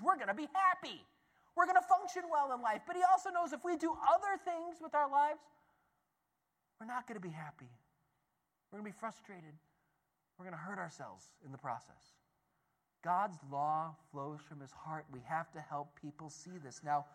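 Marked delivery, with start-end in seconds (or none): 0.0-5.4 rising, brighter and louder on the happy life; bright, higher, a bit louder; upbeat. Shown as a demonstration. Contrasting happy life with unhappy. 6.8-12.1 soft, dropped, a touch somber; downcast. Shown as a demonstration. Contrasting happy life with unhappy.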